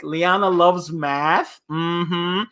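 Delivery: usual speaking voice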